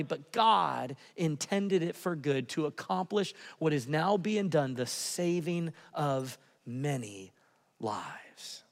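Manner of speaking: slows and fades to a whisper; fading reverent reading. Acted. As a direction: let the verse die away softly. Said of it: "slowing scripture to whisper".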